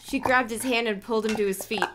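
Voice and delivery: usual speaking voice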